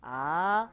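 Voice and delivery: "A" rising, loud; second tone. Shown as a demonstration. Teacher modelling the rising second tone. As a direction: push "A" upward. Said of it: "nada kedua tuh".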